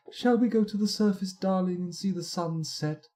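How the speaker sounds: high-pitched mock romantic invitation; imagined fortress dweller. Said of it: "That's what it's like".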